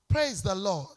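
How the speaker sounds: usual speaking voice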